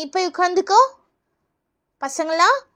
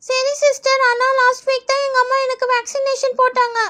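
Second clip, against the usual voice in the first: higher-pitched schoolkid explaining; child pupil; 4 semitones higher than usual. Acted. Pupil telling nurse about last week's vaccination, child voice. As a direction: speak as a little pupil.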